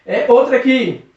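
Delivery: ordinary delivery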